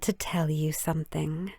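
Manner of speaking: a confiding whisper. A narrator's reading. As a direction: lean in and whisper it.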